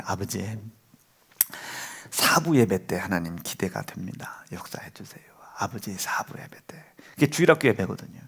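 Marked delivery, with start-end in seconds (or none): 0.0-6.8 hushed whispered plea to God; whispered prayer. Retold or imitated. Father silently praying in church for his son, whispered. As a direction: breathe the prayer under your breath.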